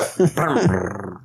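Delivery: rolled, rumbling engine start; imitated engine noise. Friend showing what turning the car key sounds like, imitated engine rumble. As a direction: make a car engine sputter with the mouth. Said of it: "you hear the".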